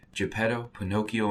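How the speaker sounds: plain reading voice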